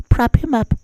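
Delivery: very high, small voice; mock coddling media. Media propping up the president, high mock coo. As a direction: squeak it like a doting helper.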